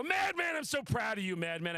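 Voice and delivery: mock self-congratulating shout; proud self-cheer. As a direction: hype yourself up like your own biggest fan. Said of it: "just go".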